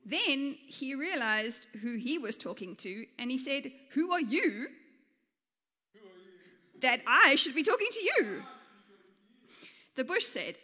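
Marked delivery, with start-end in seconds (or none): 3.9-8.4 raised, emphatic story-character challenge; mock-indignant Moses; turning the question back. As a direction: throw the question back, building louder. Moses turns the question on the bush.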